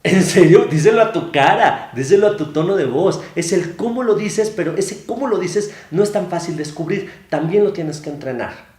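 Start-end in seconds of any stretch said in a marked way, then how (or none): 0.0-3.2 loud incredulous challenge; sarcastic indignant retort; mock disbelief. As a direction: call out the fake tone. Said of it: "mock reply".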